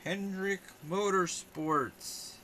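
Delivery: high, loud showman build-up; slow, high game-show reveal; mock dramatic reveal. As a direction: tease it like a big announcement. Mock reveal of matching card.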